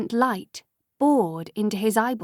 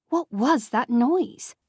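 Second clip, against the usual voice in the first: ordinary delivery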